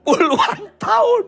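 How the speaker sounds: high, loud exclaim of decades; emphatic exclaim. Real, not acted. Preacher calls out high and loud to the congregation.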